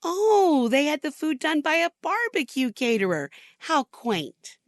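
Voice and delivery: drip fake politeness over a backhanded compliment; sister-in-law mocking the barbecue caterer, syrupy condescension; condescending sister-in-law. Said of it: "wrap her condescension in a series of backhanded compliments".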